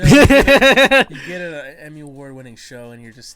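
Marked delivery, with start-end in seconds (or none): 0.0-1.1 laughing out loud, high-pitched; words lost in a burst of laughter. Real, not acted. Bursts out laughing mid-sentence.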